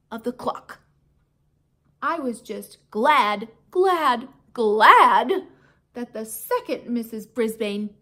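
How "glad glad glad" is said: glad growing louder each time; building glad. A narrator's reading. Hamster relieved the teacher is gone, growing louder. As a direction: build glad to a joyful shout.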